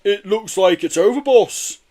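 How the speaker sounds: loud henchman. Acted. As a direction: report to the boss. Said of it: "said Blister".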